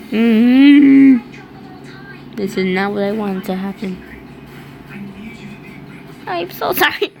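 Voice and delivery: high squeaky embarrassed reaction; flustered squeal. Real, not acted.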